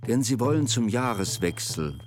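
usual speaking voice

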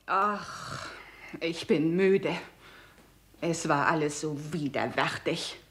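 soft, drained sigh of exhaustion; weary resignation. Acted. Gloria, worn out, admits it was all vile, soft and drained. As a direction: sound utterly spent and disgusted. Exhausted woman before suicide.

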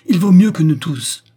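loud, high impassioned outburst; angry defiance. A narrator's reading. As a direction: defend someone fiercely. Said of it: "La colère gonflait ses narines palpitantes".

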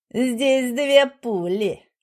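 very slow menacing warning. A narrator's reading. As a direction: warn with cold menace. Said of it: "предупредила Тихомирова".

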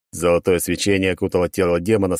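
ordinary delivery

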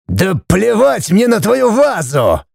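high-pitched, slow strained roar; furious roar. A narrator's reading. Judge roaring back at wife, furious. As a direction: stretch each word with rage. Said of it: "взревел судья".

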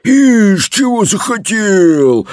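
high, drawn-out indignant dragon cry; shouting dragon head. A narrator's reading. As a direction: cry out slowly like an affronted dragon. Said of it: "закричала одна из драконьих голов". (протяжный крик драконьей головы)

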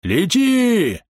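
high-pitched, drawn-out launching cry; urgent command. A narrator's reading. Bom hurls the alchemist over the trap, calling urgently. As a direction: send him flying with a high call.

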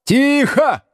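high-pitched teacher's yell; sharp, high call for silence; barked order. Acted. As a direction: bark it at a rowdy class. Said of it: "гаркнул Слушкин".

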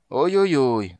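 high, loud mock wailing; lamenting wail. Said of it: "запричитал будущий сотник".

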